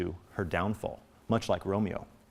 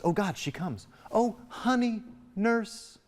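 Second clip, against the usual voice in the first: gushing, much higher sugary Juliet; sweet-talking shift; 11 semitones higher, slower than usual. Acted. Juliet greeting the nurse she grumbled about, suddenly sweet-talking. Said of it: "watch this shift in her tone when the nurse comes in".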